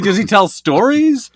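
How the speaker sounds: ordinary talk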